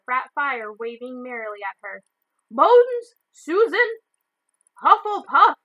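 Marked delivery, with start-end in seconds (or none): 2.5-4.0 high, loud roll call; calling out a name. A narrator's reading. McGonagall calls the next student to the stool, loudly. 4.8-5.6 much higher, loud announcing shout; hat shouts. A narrator's reading. The hat announces the house to everyone, shouting. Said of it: "shouted the hat again".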